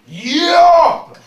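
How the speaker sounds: a huge high savage yell; barbaric yawp. Shown as a demonstration. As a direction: roar it like a barbarian. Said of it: "un cri de barbare".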